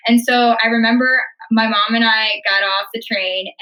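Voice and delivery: ordinary talk, nothing marked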